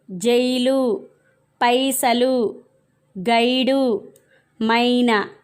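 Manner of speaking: measured word list, each first syllable a full "ai" as in "సైకిలు"; ai-sound demonstration. Shown as a demonstration. Teacher showing pupils words with ఐత్వం, carefully drawn-out demonstration. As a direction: stretch the gliding "ai" opening "దైవం", "పైరు". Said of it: "మొదటి అక్షరం ఐత్వం ధ్వని వచ్చేలా పలకాలన్నమాట".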